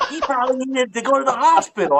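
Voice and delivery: high-pitched retold telling-off, amused; mock scolding mom. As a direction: imitate mom yelling, half-laughing. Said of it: "my mom yelled at me afterwards".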